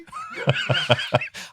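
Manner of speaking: shrieking high laugh. Real, not acted. Laughs at own meme voice.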